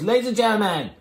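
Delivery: high, drawn-out show introduction; stage announcer. Retold or imitated. Host bringing the next comic onto stage, hammed-up announcer. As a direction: imitate a host hyping the next act, stretching the name.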